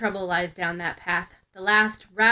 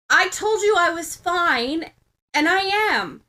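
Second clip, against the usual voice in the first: higher, slow, firm pushback; rallying defiance; 8 semitones higher, 5 dB louder than usual. Acted. Ochako pushes back at Bakugo, higher and slow. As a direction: stand your ground, higher and slower. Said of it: "rallies all the strength she can".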